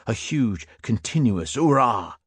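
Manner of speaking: ordinary delivery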